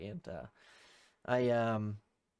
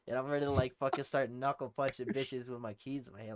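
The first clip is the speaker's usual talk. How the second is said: ordinary delivery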